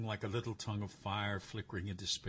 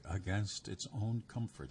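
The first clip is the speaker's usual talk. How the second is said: plain reading voice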